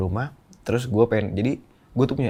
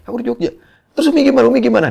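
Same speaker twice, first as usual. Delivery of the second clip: much higher, frantic phone exchange; retold panicked call; 8 dB louder, faster than usual. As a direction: relive the alarmed call to his brother. (panik menelepon abang, suara jauh lebih tinggi)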